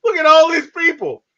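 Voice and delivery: high, loud amazed outburst; excited exclaim. Retold or imitated. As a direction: gasp it out like you can't believe it. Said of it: "And I'm like".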